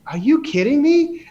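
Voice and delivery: high, incredulous mock outburst; retold disbelief. Retold shocked reply to accusation.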